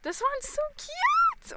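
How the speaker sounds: very high, loud squeal; delighted squeal. Real, not acted. Streamer looking at fan art, delighted squeal.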